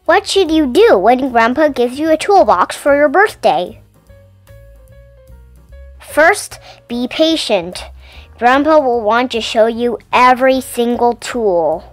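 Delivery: usual speaking voice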